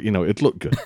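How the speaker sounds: ordinary delivery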